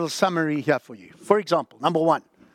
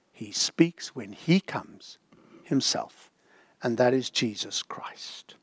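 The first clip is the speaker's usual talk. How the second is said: hushed; 5 semitones lower, 6 dB quieter, slower than usual. Acted. Soft slow climax naming Jesus.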